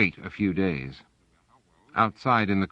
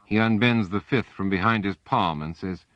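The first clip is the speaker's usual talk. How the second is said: plain reading voice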